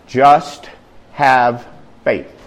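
"Just", "have", "faith" each set apart; deliberate pauses. A narrator's reading. Preacher delivering Jesus' three words, word by word. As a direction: drop the pitch down to a low "faith".

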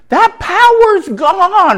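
triumphant. Acted. High slow second take.

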